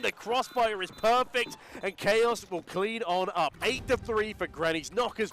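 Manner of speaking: excited commentary. Real, not acted. Caster calls a big kill.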